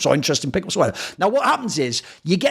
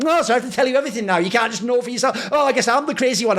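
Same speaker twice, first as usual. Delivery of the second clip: high, rushed, put-on indignant voice; mock feminine huff; 5 semitones higher, faster than usual. Host mimicking someone refusing to explain their anger, mock huffy. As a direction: imitate an aggrieved sulker, pitched up and starting fast. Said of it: "mad feminine coded thing".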